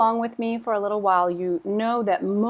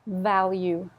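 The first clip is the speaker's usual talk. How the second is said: normal talking voice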